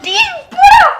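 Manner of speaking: high loud frightened yell; scared shout. Real, not acted. (பயத்தில் கத்தல்)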